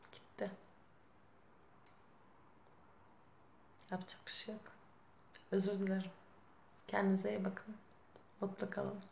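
quiet, deflated resignation; dejected. Real, not acted. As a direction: apologise softly, as if all energy has drained away. Resigned apology over the lost video. (üzgün, kısık sesle kabullenme)

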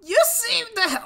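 very high cartoon hero voice; Naruto. Streamer reading game text aloud, high Naruto impression. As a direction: put on a high-pitched anime character. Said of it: "I gotta do my Naruto voice".

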